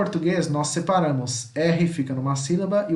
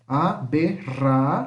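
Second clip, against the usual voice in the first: a - be - rra - ción, pause after each; spaced-out syllables with trilled rra; syllable separation. Shown as a demonstration. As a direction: split aberración into syllables. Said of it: "separação de sílaba".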